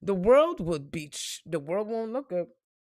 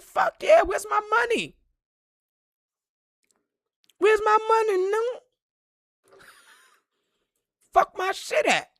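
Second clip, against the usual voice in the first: much higher, loud hyped demand; excited mock outrage; 13 semitones higher, 5 dB louder than usual. Demanding his imagined billions, high loud hyped shouting. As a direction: yell for your money like a hype man.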